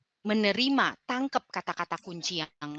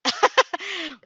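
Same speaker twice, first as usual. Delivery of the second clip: laugh; 10 semitones higher, 7 dB louder than usual. Real, not acted. Laughs at own flustered demo.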